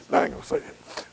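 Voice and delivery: hushed, chuckled aside; laughing. Real, not acted. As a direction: hold back a laugh while declining to tell. Chuckles at his own memory.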